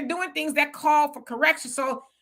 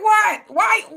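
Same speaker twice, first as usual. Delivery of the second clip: mock innocent, much higher and louder; innocent what; 10 semitones higher, 7 dB louder than usual. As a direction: play dumb, wide-eyed. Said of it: "And I'm like".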